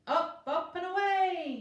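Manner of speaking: high, very loud slow heroic yell. A narrator's reading. Zeus yelling to Pegasus to take off, triumphant. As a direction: bellow it as a rallying cry. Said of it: "yelled Zeus".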